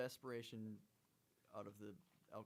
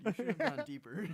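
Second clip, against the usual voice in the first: laughing; 8 semitones higher, 4 dB louder, slower than usual. Real, not acted. Teasing joke with laugh.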